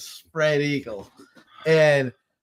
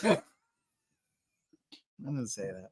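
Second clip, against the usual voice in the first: normal talking voice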